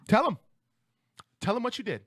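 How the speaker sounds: high-pitched short command; stern mom ordering. Retold or imitated. Mom making sobbing child confess to manager, insistent.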